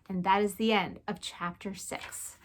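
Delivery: ordinary delivery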